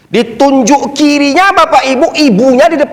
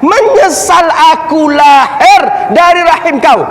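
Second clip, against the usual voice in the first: high, emphatic mock rebuke; full conviction; 6 semitones higher than usual. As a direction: declare it forcefully as the defiant son. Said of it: "dengan penuh keyakinan". (menirukan anak durhaka dengan penuh keyakinan)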